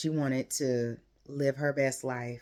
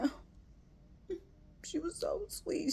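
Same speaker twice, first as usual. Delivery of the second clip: much higher, airy gush of sweetness; melting squeal; 8 dB quieter, much slower than usual. Retold or imitated. Recalling the child's sweet question, squealing high and airy. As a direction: squeak it out like you're about to cry from cuteness. Trying to keep eyes dry.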